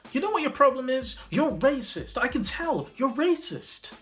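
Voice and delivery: high-pitched, hammed-up accusation; imitated accusing diner. Diner after a few drinks accusing the manager, imitated indignation. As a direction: pipe up as an indignant customer.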